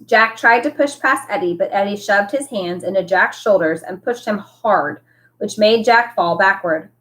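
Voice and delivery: usual speaking voice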